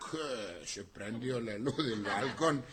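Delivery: ordinary delivery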